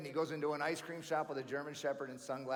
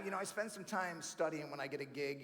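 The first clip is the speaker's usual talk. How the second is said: normal talking voice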